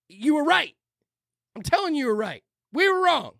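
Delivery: high-pitched, exasperated ranting; worked-up rant. Real, not acted. Host telling former opponents they were right, worked-up rant. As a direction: rant insistently at the camera. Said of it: "not screaming at the camera".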